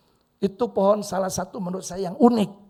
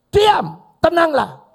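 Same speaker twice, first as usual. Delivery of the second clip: slow commanding shout; 8 semitones higher, 11 dB louder, much slower than usual. Acted. Jesus commanding wind and waves, slow loud shout. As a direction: draw out a loud, high command. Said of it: "omongannya Yesus".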